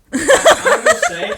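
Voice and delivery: high squealing laugh through the words. Real, not acted. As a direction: laugh hard while saying it.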